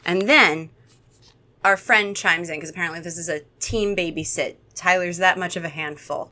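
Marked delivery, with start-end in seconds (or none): none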